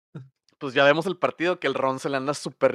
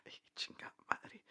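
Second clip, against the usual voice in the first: susurro sin voz, pillado; Harry atrapado susurra; 21 dB quieter than usual. Retold or imitated. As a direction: maldice bajito, descubierto. Harry atrapado por Luna. (Harry susurra al ser descubierto por Luna)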